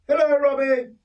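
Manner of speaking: put-on old Kay chiding the boy about hair oil, high voice; voicing Kay. Kay greets Robbie.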